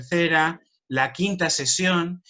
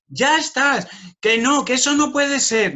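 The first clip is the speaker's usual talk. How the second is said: much higher, louder overplayed shock; exaggerated disbelief; 9 semitones higher, 4 dB louder than usual. Teacher hamming up disbelief to show a pupil a joke, much higher and louder. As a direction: gasp out the fake outrage, way over the top. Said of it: "intentando exagerar muchísimo".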